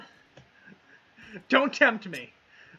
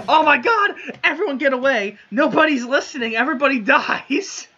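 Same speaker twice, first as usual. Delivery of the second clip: higher, louder mock panicked yell; re-enacted yelling; 6 semitones higher, 4 dB louder than usual. Player retelling his ignored grenade warning, mock yell. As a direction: act out yelling a warning at the group. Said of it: "I'm yelling".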